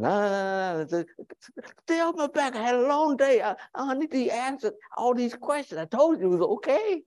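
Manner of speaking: high, exasperated mock protest; irritated man brushing off. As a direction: push her away, fed up. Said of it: "if you keep pressing him".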